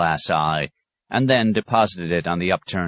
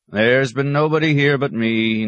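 bleating goat; 7 semitones higher, 4 dB louder than usual. Retold or imitated. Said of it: "taught to bleat".

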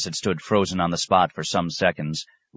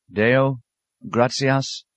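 timid question; 7 semitones higher, much slower than usual. A narrator's reading. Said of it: "asked Francis".

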